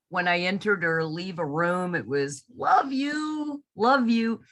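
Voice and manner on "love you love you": put-on high, sweet little old lady. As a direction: coo it like a doting grandma. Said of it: "the voice of the elderly lady".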